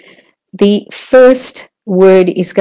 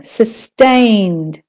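"sustained" with second syllable held; stress on TAINED. Shown as a demonstration. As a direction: stretch the end syllable of "sustained". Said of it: "is longer and louder".

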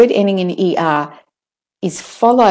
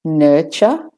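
"nurture" with no r in either syllable, rising; British-Australian r-less "nurture". Shown as a demonstration. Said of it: "no R in the U R".

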